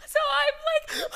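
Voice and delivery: high wailing laugh-cry; mock sob, high and breaking; imitating her own sobbing; imitated sobbing.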